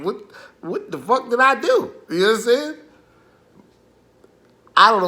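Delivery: loud high outraged question; outraged disbelief. Retold or imitated. Telling listeners about the fight challenge, loud outraged question. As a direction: yell it, baffled and indignant.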